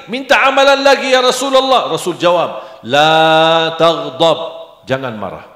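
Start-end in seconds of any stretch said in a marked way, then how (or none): 0.1-1.9 high, loud pleading voice; pleading companion. Acted. Sahabat meminta lagi. 2.8-4.4 slow solemn reply. Acted. Rasul menjawab berulang.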